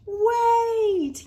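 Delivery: high loud shout of wait; yell. Acted. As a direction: call out to stop the train. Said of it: "yelled Missy May".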